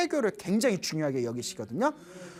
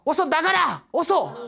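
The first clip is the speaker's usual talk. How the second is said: higher, louder urgent cry; mother's loud warning; 9 semitones higher, 4 dB louder, slower than usual. Retold or imitated. Mother warning her son in the barracks, urgent loud cry. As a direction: shout the warning like a frightened mother. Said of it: "엄마 목소리가 크게 들리더라는 거예요".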